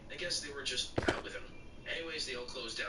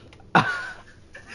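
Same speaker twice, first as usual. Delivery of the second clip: real laugh; 13 dB louder than usual. Real, not acted. Laughs at a slapped pickup artist.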